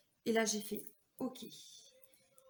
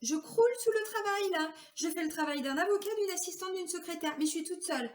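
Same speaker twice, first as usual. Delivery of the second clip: pleading complaint; 6 semitones higher than usual. Retold or imitated. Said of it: "je disais à mes patrons".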